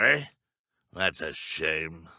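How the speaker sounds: ordinary talk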